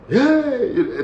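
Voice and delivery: high, mild mock yell of "Hey!"; mock warder shout. Warders greeting arriving inmates, mock yelling. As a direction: bark it like a prison warder, half-hearted. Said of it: "they start screaming".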